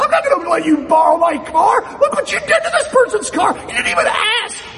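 high-pitched, loud comic rant; acted yelling. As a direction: scold her at a full, over-the-top shout. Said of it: "Oh, please stop yelling".

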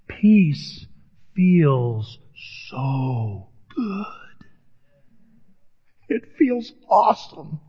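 crying through the words; choked up. Real, not acted. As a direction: voice breaking with emotion, very slow. Emotional confession in sermon.

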